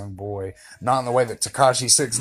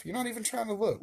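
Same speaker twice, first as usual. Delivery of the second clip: pet talk; 11 semitones higher, 7 dB quieter, faster than usual. Real, not acted. Teasing his dog.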